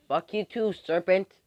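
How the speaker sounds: high-pitched comeback, rising at the end; taunting comeback. Acted. Rival answering Diana's insult, high taunting.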